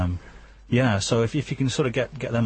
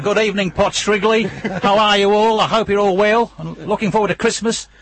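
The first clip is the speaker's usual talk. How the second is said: loud, high hammed-up Australian accent; over-the-top Aussie greeting, Australian accent; 11 semitones higher, 7 dB louder than usual. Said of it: "Can you do the interview from now on in Australian accent".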